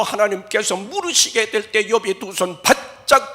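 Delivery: full-force preaching. Real, not acted. Preacher voicing God's pointed questions, loud and high. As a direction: proclaim it over the music.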